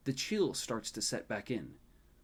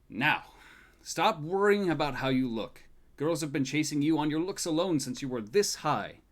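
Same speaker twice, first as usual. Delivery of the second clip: louder, chiding grandpa; fond scolding grandfather; 5 semitones higher, 8 dB louder than usual. Acted. Grandfather fondly scolding the groom about his looks, louder. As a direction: tell him off with love.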